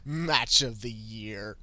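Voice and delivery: drawn-out sarcastic repeat; slow mocking echo. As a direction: stretch it out, mocking the hype. Host mockingly echoes the hype.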